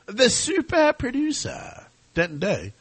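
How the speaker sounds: high, slow, stretched-out announcer voice; hype announcer intro. Acted. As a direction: build up the producer like a ring announcer. Hyping up the producer's intro.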